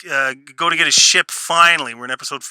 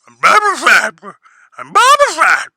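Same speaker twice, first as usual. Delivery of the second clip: "I'm Boba Fett" swelling much higher and louder into a mocking shout; mock him shouting his name ever louder; mock Boba Fett, building; 7 semitones higher, 7 dB louder than usual. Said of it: "Bro, you're telling everybody who you are".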